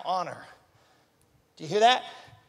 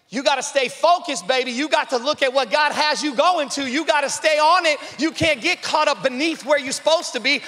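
loud, high, driving sermon delivery; full-force preaching; 6 semitones higher, 6 dB louder than usual. Acted. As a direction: preach it hard, building the crowd up.

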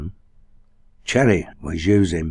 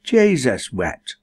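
slow, higher, emphatic; 5 semitones higher, 3 dB louder, slower than usual. A narrator's reading. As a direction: Nan insulted by the mother, emphatic. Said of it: "she said with emphasis".

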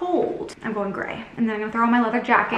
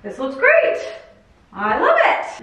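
much higher, pleased and bright; delighted; 5 semitones higher than usual. Real, not acted. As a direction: gush over it happily. Admiring finished decor.

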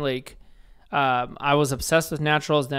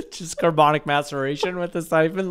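laughing; 2 semitones higher than usual. Real, not acted. Laughing joke, cheering.

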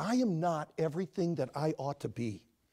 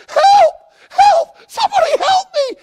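very high, loud panicked shout; screaming for help; 20 dB louder than usual. Acted. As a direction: scream it in terror. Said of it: "and he says".

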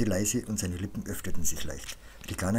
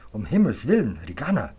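dramatic, raised exclamation; exasperated outcry; 3 semitones higher, 8 dB louder than usual. A narrator's reading. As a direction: cry out in alarmed indignation. Scrubbed character cries out in protest.

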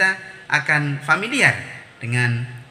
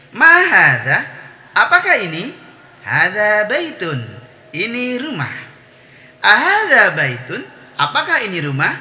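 question-and-answer drill; 6 semitones higher, 5 dB louder than usual. Shown as a demonstration. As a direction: model each phrase with strong questioning and answering tunes.

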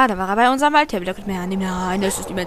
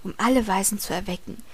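whispered reading; 3 semitones lower, 7 dB quieter than usual. Acted. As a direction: read it like a secret. Reads game text in whispery voice.

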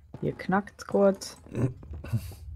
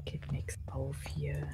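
whispering; 4 semitones lower, 16 dB quieter, slower than usual. Real, not acted. Whispering while exploring haunted house.

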